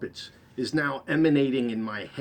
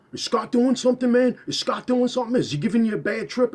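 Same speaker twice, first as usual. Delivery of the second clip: much higher, louder and fast; worried bandmate; 11 semitones higher, 6 dB louder, faster than usual. Retold or imitated. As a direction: rattle off the questions high and anxious. Bass player asking urgently.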